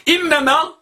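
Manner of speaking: ordinary talk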